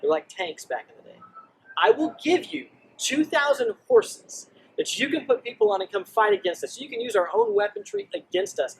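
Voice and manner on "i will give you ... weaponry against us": mocking taunt. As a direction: sneer it out like a cocky king. Enemy king's taunting letter.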